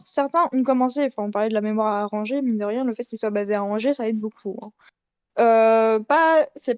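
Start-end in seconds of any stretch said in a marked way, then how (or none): none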